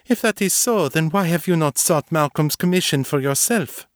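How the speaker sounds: high, wary questioning; suspicious. A narrator's reading. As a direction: challenge her with suspicion. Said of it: "felt a twinge of suspicion".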